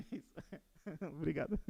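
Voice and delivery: light laugh through the words; amused thanks. Real, not acted. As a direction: thank them with a small, real chuckle. Laughs over slide mix-up while presenting.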